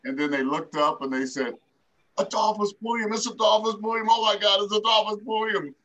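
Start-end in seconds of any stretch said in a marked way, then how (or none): 2.2-5.7 high, excited cry of his name; excited recognition. Retold or imitated. The Jacksons greeting their old neighbor, high and excited. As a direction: burst out as if spotting an old friend.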